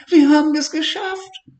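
put-on very high, loud child's whoop of joy; joyful cheer. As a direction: cheer like a thrilled boy. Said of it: "rief Levi". (lauter, hoher Jubelruf)

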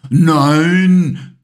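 put-on young lion roars his objection; roaring no. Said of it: "brüllte Simba".

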